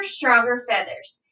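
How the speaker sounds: ordinary delivery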